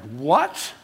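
incredulous, with a steep rising pitch; retold astonished question; stunned disbelief. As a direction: exclaim it like you can't believe your ears. Coworker stunned by church question.

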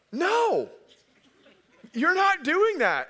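high, loud sisterly no; shrill warning from the older sister; sister's protest. Retold or imitated. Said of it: "my oldest sister ... is like".

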